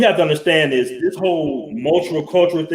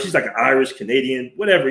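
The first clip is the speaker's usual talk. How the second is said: ordinary delivery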